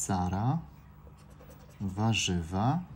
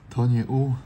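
louder, emphatic to nie U; loud exclamation; 3 semitones higher, 8 dB louder than usual. Real, not acted. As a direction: call it out in annoyed realisation. Exclaims letter is missing. (głośno, z irytacją)

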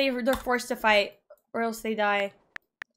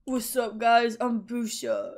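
slower, quieter take on a friend's voice; Boo Shot impression voice; 3 semitones lower, 4 dB quieter, slower than usual. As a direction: redo the impersonation, slower. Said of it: "At least do a good impression".

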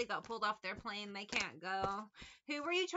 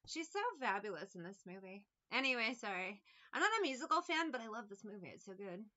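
ordinary delivery

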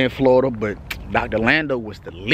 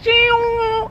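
excited whoop; 3 dB louder than usual. Real, not acted. Exclaims walking outside.

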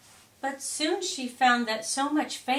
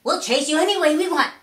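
fast, loud, high crowd voice; louder, rapid fan chant; rowdy fans; 8 semitones higher, 10 dB louder, faster than usual. A narrator's reading. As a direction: rattle it off like a pushy mob. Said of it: "they said".

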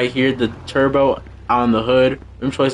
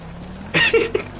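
high breathless squeal of laughter; a squealing giggle, too hyped to hold it; excited shriek laugh; 4 dB quieter than usual. Real, not acted. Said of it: "Sorry, I'm too excited".

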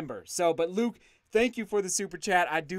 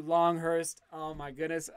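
ordinary talk, nothing marked

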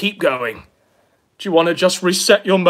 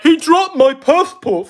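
high, loud mock complaint; imitating an outraged whiner; mocking imitation; 8 semitones higher, 5 dB louder than usual. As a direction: parrot him in a raised, loud voice. Mocking replay of the played clip.

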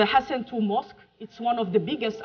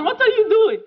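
amused mock surprise; 10 semitones higher, 7 dB louder than usual. Retold question to colleague.

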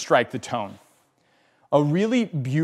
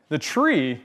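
ordinary talk, nothing marked

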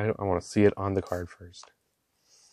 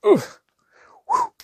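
startled oof; 10 dB louder than usual. Real, not acted. Relief after a near disaster.

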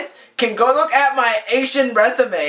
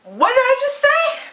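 incredulous; 13 semitones higher than usual. Retold or imitated. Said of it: "And I was like".

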